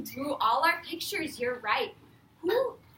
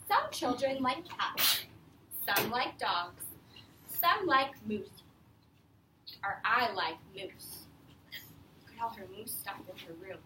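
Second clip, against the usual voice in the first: put-on reading to children; 4 semitones lower than usual. Teacher reading The Useful Moose to her class, lively read-aloud. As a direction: read it out to a class of young kids. Reads picture book to class.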